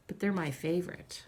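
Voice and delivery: ordinary delivery, nothing marked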